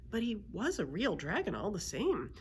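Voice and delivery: ordinary delivery, nothing marked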